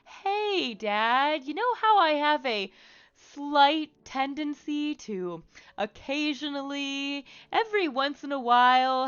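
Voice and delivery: high, drawn-out mock-sheepish confession; coy, exaggeratedly careful phone-call opener to dad; joking understatement. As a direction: tiptoe up to it with hammed-up, halting delicacy. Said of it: "I meant it as a joke".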